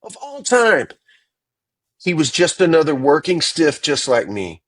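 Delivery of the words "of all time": "Of all time" much higher, each word hit hard; emphatic repeat. Real, not acted. [name] insisting Sting is the greatest, emphatic. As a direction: repeat it to drive it home.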